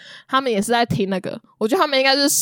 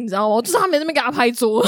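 ordinary talk, nothing marked

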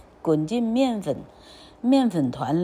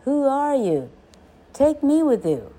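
higher-pitched eager call; 7 semitones higher, 3 dB louder than usual. A narrator's reading. Ugly duckling to swans overhead, calling out. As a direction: call up to someone far away. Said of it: "He calls to them".

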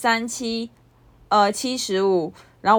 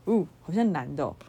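mocking classmate; 4 semitones lower, 6 dB quieter than usual. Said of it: "同班同学就说".

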